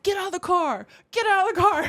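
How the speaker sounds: high, loud, half-laughing yell at another driver; furious driver shouting from her stopped car; road rage yell. Retold or imitated. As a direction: relive the road rage, screaming and cracking up. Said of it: "getting out of the car and being like".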